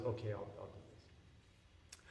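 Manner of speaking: slow, hushed agreement; soft assent. Retold or imitated. The Brahmana consents to cook, quiet assent. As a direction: agree quietly, trailing almost to a murmur.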